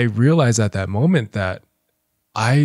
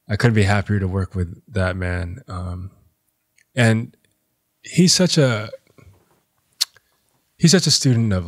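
ordinary talk

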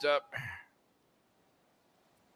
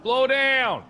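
loud, high holler; angry shout; 10 semitones higher, 6 dB louder than usual. Pretend. Bystander to speeding driver, angry shout. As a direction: yell it out at a passing car.

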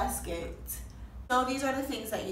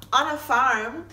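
ordinary talk

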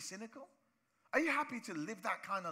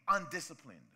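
usual speaking voice

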